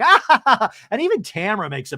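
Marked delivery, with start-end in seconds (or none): 0.0-0.7 high, loud ha-ha cackle; imitated laugh. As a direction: mimic a woman's shrieking laugh. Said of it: "Alexis is like".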